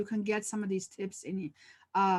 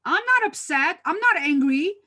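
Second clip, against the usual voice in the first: imitating a loud, high-pitched protest; mock-indignant, raised-voice denial; angry denial; 7 semitones higher, 6 dB louder than usual. Upset staff member denying anger to the manager, loud retold protest. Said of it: "you're really upset and angry".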